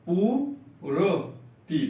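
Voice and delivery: पूर्ति stretched as पू-र-ति, very slow; teacher sounding out पूर्ति; slow syllable reading. Shown as a demonstration. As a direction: draw out the half रा in पूर्ति. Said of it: "आधा रा का उच्चारण होगा".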